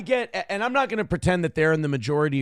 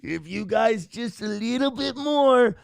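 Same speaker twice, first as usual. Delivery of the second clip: higher, drawn-out greedy inner voice; mock-eager inner thought, slow and rising in pitch; pretend salivating eagerness; 4 semitones higher, slower than usual. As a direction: play barely contained greed, stretching the words.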